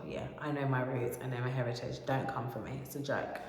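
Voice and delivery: usual speaking voice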